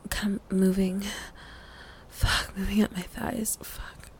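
soft, faltering, breath-heavy murmur; overwhelmed and trailing to almost nothing; flustered whisper. Acted. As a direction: lose the thread into a whisper. Flustered by being touched.

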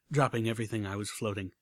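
usual speaking voice

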